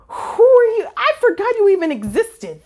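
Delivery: high, incredulous confusion; baffled disbelief. Retold or imitated. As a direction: sound utterly lost. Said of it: "I was like".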